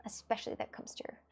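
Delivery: breathy whispered aside, no voice at all. Pretend. Whispering a chat line back to the viewer. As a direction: hush it to nothing, as if to yourself.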